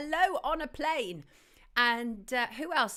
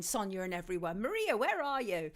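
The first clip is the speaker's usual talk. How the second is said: ordinary delivery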